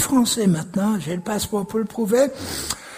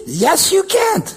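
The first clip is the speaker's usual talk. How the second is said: high-pitched mocking devil, CAN'T stressed; acted devil, raised pitch on "can't"; devil's taunt; 7 semitones higher, slower than usual. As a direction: jeer it high like the devil discouraging someone. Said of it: "Le diable, il dit".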